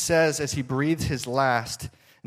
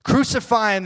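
much higher, loud mob cry; the crowd demanding death, loud and high; crowd shouting; 10 semitones higher, 7 dB louder than usual. Retold or imitated. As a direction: yell it out as the mob. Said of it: "they shout".

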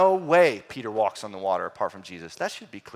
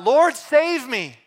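high, loud, slow desperate cry; loud, slow plea of a sinking man; 11 semitones higher, 8 dB louder, much slower than usual. Retold or imitated. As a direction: cry out for rescue while sinking. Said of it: "cried out".

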